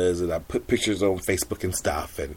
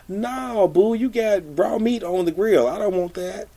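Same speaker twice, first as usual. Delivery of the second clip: sassy refusal; 11 semitones higher than usual. Retold or imitated. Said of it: "reply to manager".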